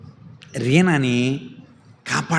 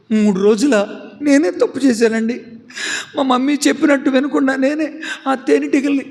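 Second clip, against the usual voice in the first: put-on distraught child; 9 semitones higher than usual. Reena telling the doctor it's her fault, distraught. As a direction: blurt out guilt in dismay.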